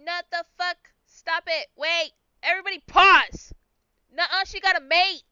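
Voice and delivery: frantic protest. Real, not acted. Player stopping the game master from taking her bird, alarmed. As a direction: stop everything, don't take my bird.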